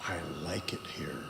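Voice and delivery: whispered on the exhale; soft whispered phrase. Shown as a demonstration. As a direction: breathe the words out unvoiced. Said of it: "I want you to say".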